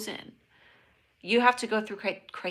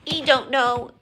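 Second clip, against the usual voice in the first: higher, louder squeaky creature voice; Ewok voice; 4 semitones higher, 7 dB louder, slower than usual. Shown as a demonstration. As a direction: redo it as a little Ewok. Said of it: "repeats in Ewok voice".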